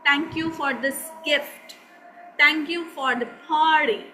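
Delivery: "thank you" with a clear dental th, said slowly; "thank you for this gift", said slowly and carefully; native-style thank you. Shown as a demonstration. Teacher showing students the native way to say thanks, slow and careful. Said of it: "अंग्रेज उसे बोलेगा thank you".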